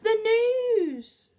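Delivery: loud call over the crowd; girl yelling over a crowd; yelled. A narrator's reading. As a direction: shout to be heard above the noise. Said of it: "above the noise she yelled".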